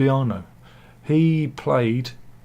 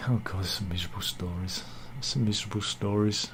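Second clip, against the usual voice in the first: whispered dismay; 4 semitones lower, 9 dB quieter than usual. Real, not acted. Dismayed at sad news.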